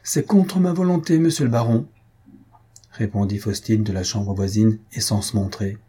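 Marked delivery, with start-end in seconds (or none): 0.0-1.9 haughty retort. Acted. Countess's cold reply from next room.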